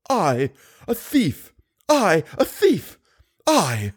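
put-on slow, high outraged old woman; choking with rage. A narrator's reading. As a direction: sputter it out in fury. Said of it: "She choked with rage".

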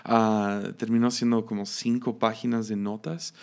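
ordinary talk

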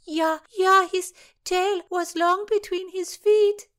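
high, loud, slow put-on voice; gleeful mocking voice. Lady mocking the beaten Sir Henry, high and loud.

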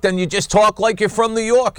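high, thick New York accent; a quick New York accent demo. Shown as a demonstration. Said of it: "if you wanna be from New York".

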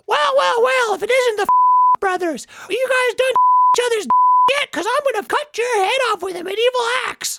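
Handy the menacing hand. Acted. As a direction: sneer like a cartoon villain. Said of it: "my hand ... and it goes".

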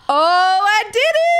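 high, loud victorious cry; triumphant squeal. Retold or imitated. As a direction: shriek with disbelieving joy. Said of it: "And I'm like".

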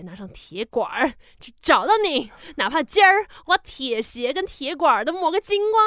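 mock Beijing erhua, ending loud; Beijing accent. Stranger vowing to find Ibolyka, in a mock Beijing accent. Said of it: "我干嘛要学中国人讲话".

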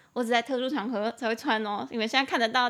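ordinary talk

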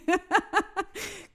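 high peal of laughter. Real, not acted. Laughing at own story. (hohes Lachen)

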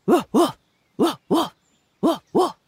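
high, loud dog woofs; dog barking. A narrator's reading. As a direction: bark in sharp pairs like a farm dog. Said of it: "a dreadful noise".

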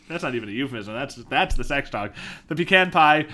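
ordinary talk, nothing marked

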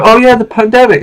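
high, dismissive mock tone; mocking quote. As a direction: brush it off like it's over. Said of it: "'cause they go".

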